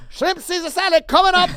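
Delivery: high, loud Italian chef turning obliging; Italian chef, suddenly eager. Retold or imitated. Italian chef agreeing at once once he hears Tyson, high and loud. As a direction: sing it out like a chef who just heard a celebrity name. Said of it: "a crazy coked out guy from Italy".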